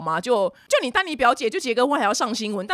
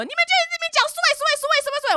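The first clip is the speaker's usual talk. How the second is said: shrill, very high rapid-fire complaint; mock very angry; much faster than usual. Regina accuses friends of discussing Suwei, mock angry shriek. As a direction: imitate her screeching the name over and over. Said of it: "还是非常的生气".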